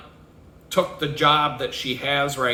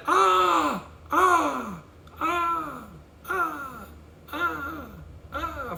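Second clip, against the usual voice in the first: high ah repeated, growing softer to near silence; fading echo; 12 semitones higher, 4 dB quieter, much slower than usual. Shown as a demonstration. Mimicking the canyon echo, high and fading out. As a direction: let the echo die away across the canyon. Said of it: "you'll hear it for a while".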